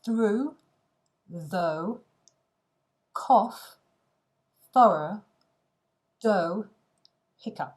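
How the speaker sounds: each word said loud and clearly, one at a time; careful teacher pronunciation of tricky English words; English pronunciation demo. Shown as a demonstration. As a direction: model through, though, cough, thorough, dough, hiccup for learners. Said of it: "ça se prononce".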